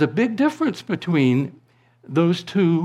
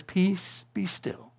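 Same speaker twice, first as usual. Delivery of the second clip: hushed calm; 5 semitones higher, 7 dB quieter, slower than usual. Acted. Quoting Jesus calming the storm.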